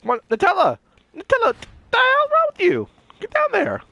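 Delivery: high-pitched scolding shout; exasperated yelling. Real, not acted. Player scolds companion Nutella, exasperated high yell. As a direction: yell at a useless teammate, voice shooting up.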